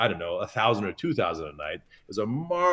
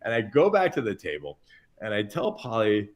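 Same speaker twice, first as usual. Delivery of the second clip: normal talking voice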